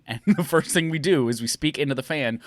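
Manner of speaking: usual speaking voice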